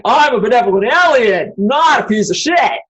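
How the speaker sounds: loud, pompous mock alien voice; mocking smug alien. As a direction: ham up a self-righteous liar, shouting not. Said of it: "walk around saying".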